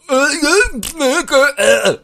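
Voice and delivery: high, loud mechanical grunts; robot grunting. Retold or imitated. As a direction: grunt like a broken voice modulator. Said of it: "речевой модулятор".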